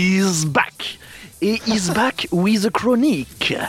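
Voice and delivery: mock English accent, a touch slow; hammed-up English-accented French host; bad English accent. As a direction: put on a clumsy English accent. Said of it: "j'ai un accent anglais de merde".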